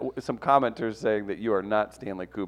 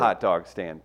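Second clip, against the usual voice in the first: ordinary talk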